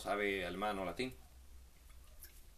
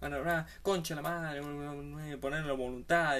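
hammed-up screaming fit of half-swallowed curses; mock Oscar-worthy yelling; 5 semitones higher than usual. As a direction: rant like an actor losing it in a car. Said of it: "empieza a gritar".

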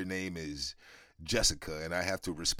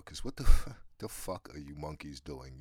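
usual speaking voice

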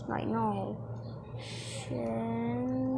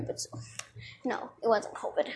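ordinary delivery

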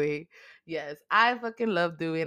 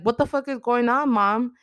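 angry demand; 6 semitones higher, 4 dB louder than usual. Retold or imitated. Daughter confronting her mother.

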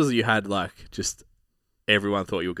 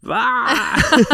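high, loud shriek into a laugh; surprised shriek; 10 dB louder than usual. Retold or imitated. Imitating fiancée's shriek when the ring came out, high and loud. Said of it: "she was like".

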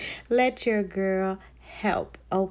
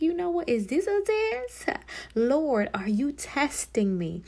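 mock bewildered; 8 semitones higher than usual. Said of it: "I'm like".

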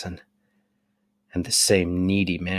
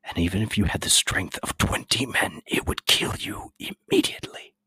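put-on thin whispered voice of a scared old man; frail apothecary whispering; fearful apothecary. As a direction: whisper like a wretched recluse.

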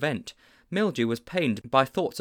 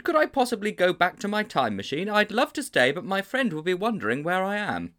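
put-on much higher, politely protesting boy; 9 semitones higher, 4 dB louder than usual. A narrator's reading. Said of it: "he protested".

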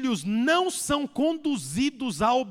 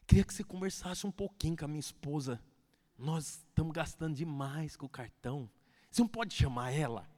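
softer, lower confiding ask; quiet request; 8 semitones lower, 7 dB quieter than usual. Retold or imitated. Husband quietly asks cell leader to talk to wife. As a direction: ask the leader for help discreetly. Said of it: "ele vai lá na torre de vigia e fala assim".

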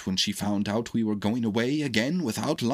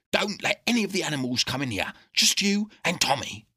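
urgent; 5 semitones higher, 3 dB louder than usual. A narrator's reading. Dog urgently summoning the doctor.

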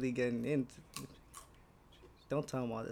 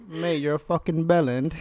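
mock British insult; bad British accent, playful; 3 semitones higher, 7 dB louder than usual. Guest joins the British accent bit. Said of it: "That's British".

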